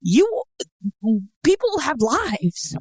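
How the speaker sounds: high, slow exasperated emphasis; pointed reminder. Real, not acted. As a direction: draw it out as a pointed, mock-polite reminder. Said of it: "friendly and politely remind you".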